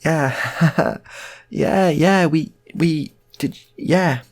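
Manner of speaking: high, loud, halting yeahs with a laugh; laughing, hesitant acted agreement; awkward agreement. Awkward family admits wishing him back.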